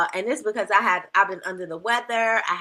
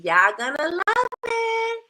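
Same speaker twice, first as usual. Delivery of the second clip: much higher and slower, sung out; high sing-song; 11 semitones higher, slower than usual. Shown as a demonstration. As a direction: lift it high and stretch it. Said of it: "said twice, second higher".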